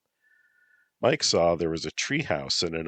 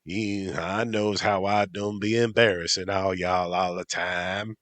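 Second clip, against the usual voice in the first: drawling hillbilly twang, slowing at the end; 3 semitones higher than usual. Acted. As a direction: lay the mock country accent on thick. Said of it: "Mike said in a hillbilly voice".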